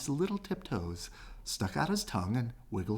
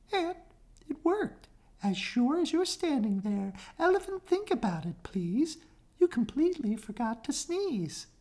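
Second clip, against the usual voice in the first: little mouse; 14 semitones higher than usual. Acted. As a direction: tease the elephant in a tiny voice. Mouse voiced high.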